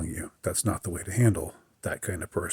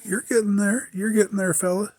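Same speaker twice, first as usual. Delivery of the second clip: much higher, sing-song patronizing coo; patronizing mockery; 12 semitones higher than usual. Mocking the know-it-all talking down to a newcomer, high patronizing coo. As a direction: pat him on the head with your voice, mocking. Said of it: "something condescending in that entire".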